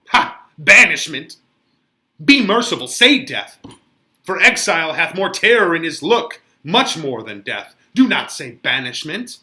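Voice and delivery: loud, anguished protest against banishment; despairing young man, raising his voice; Romeo's outburst. Acted. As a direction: cry out the word like a wound.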